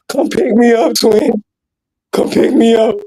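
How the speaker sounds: high, whiny imitated plea; mock boohoo crying. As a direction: imitate a sobbing friend begging for a ride. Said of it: "He boo-hoo crying".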